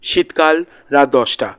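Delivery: plain reading voice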